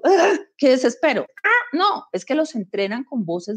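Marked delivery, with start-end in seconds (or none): 0.0-1.2 mock exasperation, higher; half-hearted fed-up groan; exasperated. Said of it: "Y yo digo". 1.4-2.1 high mock exasperated cry; a touch of comic despair. Frustrated outburst about assistants.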